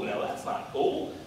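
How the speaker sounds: slow, low, under the breath; muttered aside. Pretend. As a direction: grumble it like a sulking crowd. Voicing the people's rejection.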